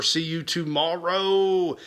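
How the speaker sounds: normal talking voice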